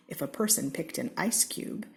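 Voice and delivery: plain reading voice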